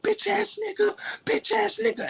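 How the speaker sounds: very high-pitched mocking voice; high mock screech at normal volume; mocking wife's insults. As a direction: imitate her in a squeaky falsetto. Imitating wife hurling insults, glass.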